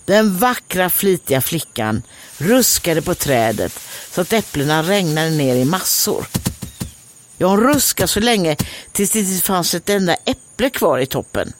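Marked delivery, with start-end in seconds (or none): none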